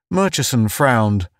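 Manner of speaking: plain reading voice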